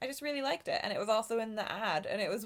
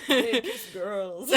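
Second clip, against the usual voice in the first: giggling; 8 semitones higher, 9 dB louder, much slower than usual. Retold or imitated. Reenacting her own giggle.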